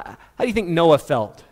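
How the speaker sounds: ordinary talk, nothing marked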